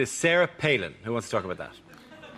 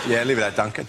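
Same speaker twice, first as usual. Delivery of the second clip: a lame attempt at an English accent, pitched lower; bad English accent; 5 semitones lower, faster than usual. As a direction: fumble through a put-on English accent. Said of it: "I really can't do an English accent".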